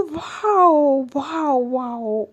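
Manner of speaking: drawn-out mock admiration, stepping down in pitch; slow, unimpressed acted sarcasm; sarcastic wows. Acted sarcastic reply in quarrel.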